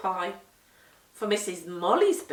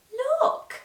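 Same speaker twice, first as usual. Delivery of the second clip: high, kind coaxing; kindly; 5 dB louder than usual. A narrator's reading. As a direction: gently urge the boy to look. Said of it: "said Missus Molly kindly".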